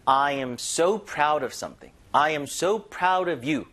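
slow demo with "so" drawn out and punched; stress on so. Shown as a demonstration. Teacher showing learners how to emphasize with so, "so" stressed. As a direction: lean hard on "so". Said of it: "강조하는 겸해서".

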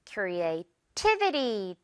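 creativity very slowly, the stress on the TI syllable; slow model word, cre-a-TI-vi-ty. Shown as a demonstration. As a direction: stretch out creativity and punch the TI. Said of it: "T 부분에 강세 주세요".

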